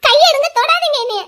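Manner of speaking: high, loud, furious shout; angry shout. Acted. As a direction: shove him off with your voice. Wife pushing husband away. (தொடாதே என்று கோபமாக கத்துதல்)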